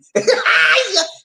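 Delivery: high shouted burst of laughter; loud high laugh. Real, not acted. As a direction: let out a big whooping laugh. Big laugh after quip.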